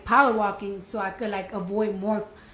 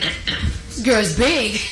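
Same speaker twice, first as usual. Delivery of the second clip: laughing; 5 dB louder, much slower than usual. Retold or imitated. Thinking to herself, laughing.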